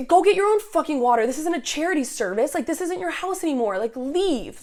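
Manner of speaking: high, angry outburst, loudest at the start; retold screaming. Resident ordering intruders out of the house, retold angry yelling. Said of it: "I am, like, screaming at them".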